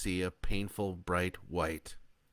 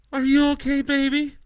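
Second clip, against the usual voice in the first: through tears; 12 dB louder than usual. Acted. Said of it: "Leah asks through her tears".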